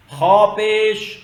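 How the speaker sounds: teacher's loud, stretched spelling-out of "خا پیش"; ہجے کی مشق. Shown as a demonstration. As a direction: draw out "خا پیش" slowly, loud and high. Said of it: "خو پڑھتے ہیں اس کو". ("خا پیش" کو کھینچ کر، بلند آواز میں ہجے کریں)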